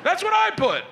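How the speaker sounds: shrill high yell of disbelief; excited outburst. Retold or imitated.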